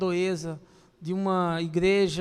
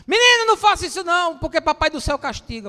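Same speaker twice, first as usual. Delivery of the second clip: much higher, louder, faster scold; hammed-up shout at a naughty boy; mock scolding parent; 7 semitones higher, 8 dB louder, faster than usual. Mock parent shouting at a child about God.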